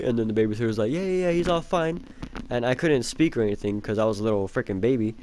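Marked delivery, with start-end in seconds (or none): 0.9-2.0 put-on high, quick-to-dismiss babysitter voice; mock breezy reassurance. Babysitter covering up to the parents, falsely breezy. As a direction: imitate a babysitter brushing off questions.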